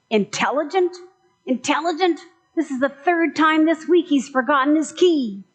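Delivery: high, loud scoffing retort; exasperated owner. Acted. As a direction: scoff at the praise, fed up. Angry owner retorts punchline.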